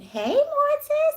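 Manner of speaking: high, slow sing-song hello; playful drawn-out shout-out; drawn-out greeting. Real, not acted. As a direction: stretch the name out like calling across a room. Greeting a viewer by name.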